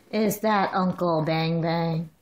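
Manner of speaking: slow hushed "Uncle Bang Bang". Real, not acted. Mother showing baby her uncle. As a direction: make it a slow little marvel.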